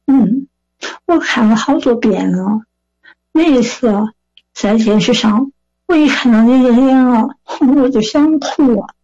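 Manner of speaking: imitated old lady from Sichuan; Sichuan dialect. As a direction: tell it as an emotional Sichuan granny. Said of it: "用四川话问我说".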